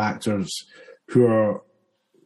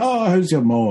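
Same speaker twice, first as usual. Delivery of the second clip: higher, livelier put-on Scottish burr; broad Scottish; 7 semitones higher, 4 dB louder than usual. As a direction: go all Scottish on a friendly greeting. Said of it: "and goes all Scottish".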